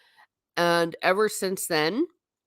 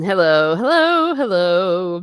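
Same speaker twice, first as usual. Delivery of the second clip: loud hello; 8 dB louder, slower than usual. Real, not acted. Greeting new arrival.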